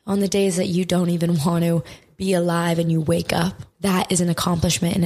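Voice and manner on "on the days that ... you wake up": normal talking voice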